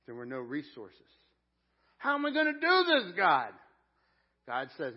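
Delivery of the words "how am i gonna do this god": loud, high mock-desperate shout to heaven; high-pitched dramatized plea at full volume; Zerubbabel crying out. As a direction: yell it up at God, acting overwhelmed. Acts out Zerubbabel crying to God.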